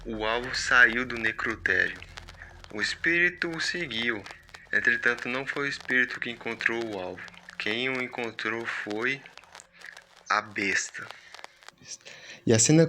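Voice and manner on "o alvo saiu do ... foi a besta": ominous text read. Acted. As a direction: read it like a creeping threat, softer with each line. Reads ominous on-screen text.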